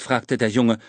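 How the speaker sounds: ordinary delivery, nothing marked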